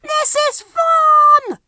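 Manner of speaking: put-on high, slow, loud children's cheer; drawn out joyful cheer. Children on the wild ride, slow high cheering. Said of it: "they cheered".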